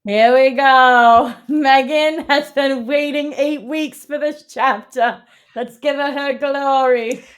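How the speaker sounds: high, slow, drawn-out build-up; hammed-up announcer. Host introducing co-host's long-awaited chapter, grand slow announcing. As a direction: hype her up like an announcer.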